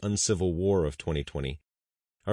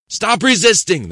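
very loud, much higher barked order; commanding yell; 13 dB louder, slower than usual. Acted. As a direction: bellow it over and over. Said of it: "they repeated".

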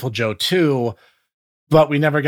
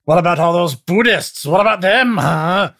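mock heckler; 5 semitones higher, 4 dB louder than usual. Skit retort.

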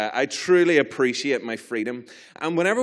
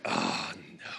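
low, hushed "uh-oh" dread; sinking dismay; 8 dB quieter than usual. Retold or imitated. Driver spotting the state trooper behind him, hushed dismay. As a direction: say it far lower and softer, heart sinking.

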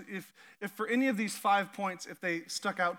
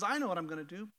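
calm God; 2 semitones lower, 5 dB quieter, faster than usual. Acted. God calmly answering the frightened people. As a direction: answer softly, fully in control.

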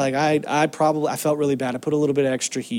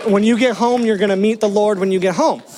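higher, louder, laughing through dad's warning; laughing mock threat; 6 semitones higher, 4 dB louder than usual. Pastor retelling his dad's paddle warning, laughing. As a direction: play the stern dad while cracking up.

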